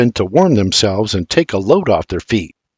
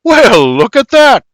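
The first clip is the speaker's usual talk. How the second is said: high, loud delighted surprise; surprised; 12 semitones higher, 6 dB louder than usual. A narrator's reading. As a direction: discover the coat rack.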